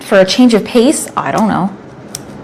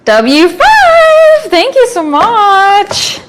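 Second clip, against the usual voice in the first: high squeaky thank-you voice; much higher, slow silly voice; high put-on voice; 9 semitones higher, much slower than usual.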